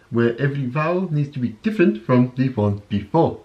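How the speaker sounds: a light South African accent, vowels shifted; joke-demo of South African vowels; teasing a South African accent; mock South African. Shown as a demonstration. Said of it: "South Africa".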